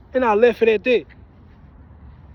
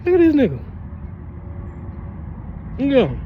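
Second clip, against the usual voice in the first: mocking call-out; 6 semitones higher, faster than usual. Real, not acted. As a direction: jeer at him. Mocking passerby.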